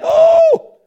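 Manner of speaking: very high, loud "ooh" for a sent text; exaggerated high-pitched hoot; high mock whoop. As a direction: hoot it out like a comic alarm. Acting out hitting send in capitals.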